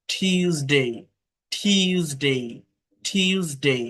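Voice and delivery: "টিউসডে" as tyooz-dei, puffed t; careful "টিউসডে" with y glide after t; correct Tuesday. Shown as a demonstration. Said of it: "টুয়েসডে না".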